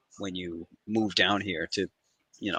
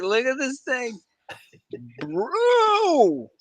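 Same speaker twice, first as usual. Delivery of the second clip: high-pitched disbelief; 14 semitones higher, 4 dB louder, much slower than usual. Real, not acted. Disbelief at the stock's price.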